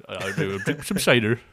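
loud Somerset "That's bite, that is" with "bite" rising; Somerset accent. Acted. Friend imitates a Somerset cider drinker loudly in Somerset accent. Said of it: "Somerset cider type of guy".

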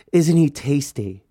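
slow, savoring question; imitating a relative's creepy relish; suggestive admiration. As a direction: linger on it as if admiring someone a little too much. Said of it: "but the way she said it".

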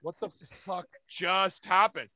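slow, hushed retold bafflement; stunned disbelief. Fan stunned by a stranger's rescue, slow hushed disbelief. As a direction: drag it out, softly dumbfounded.

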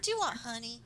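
imitating a Southern accent; light Southern twang on an actress's line. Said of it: "Riley has a southern accent".

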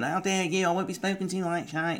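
hammed-up outrage, zero to furious in a breath; mock outraged scolding. Dad imitating the mum's sudden mock outrage at their daughter. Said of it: "it's nought to a thousand".

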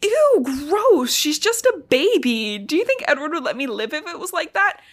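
hammed-up "ew, gross"; grossed-out Jacob. Jacob recoiling at the idea, acted with a laugh. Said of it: "And he's like".

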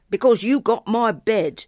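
put-on loud, resentful boy's retort; grumbling. A narrator's reading. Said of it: "the boy grumbled".

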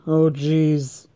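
a smoker's weary "Oh, jeez" then a rasping laugh; mock Tammy, hoarse. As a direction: sigh it out like a worn-out mom.

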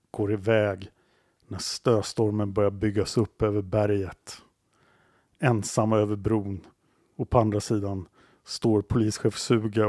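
slow, deliberate storytelling with weighty pauses; suspenseful story narration. A narrator's reading. Storyteller sends two men into the snowstorm, suspenseful narration. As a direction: draw out the cliffhanger phrase by phrase.

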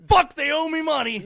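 loud, high, gruff put-on voice; put-on Frank voice. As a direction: bark it out in a character voice. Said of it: "Frank's like".

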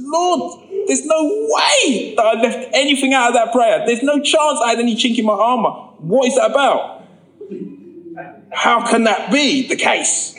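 high pleading complaint to God; indignant prayer. Retold or imitated. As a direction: argue with the Lord, aggrieved.

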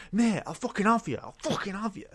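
high, mock-furious threat; drunk threat. Drunk man bursting in threatening the narrator, mock furious. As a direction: ham up a drunk lad's threat. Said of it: "he comes through the door and he goes".

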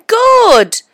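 high, loud cheer of good; delighted. Real, not acted. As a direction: cheer it out brightly. Cheering friend's outing.

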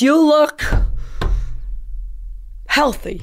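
long pause before "healthy"; hesitant tact. Retold or imitated. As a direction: raise "look", then hold back "healthy". Retold tactful verdict, long pause.

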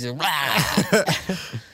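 sudden loud, high demon roar. Acted. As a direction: burst into a mock monster roar. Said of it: "turns into a demon face".

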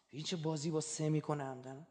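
ordinary delivery

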